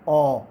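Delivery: ओ held long and low; long o sound. Shown as a demonstration. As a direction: draw out the vowel ओ, low and loud. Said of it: "ओ longer थी".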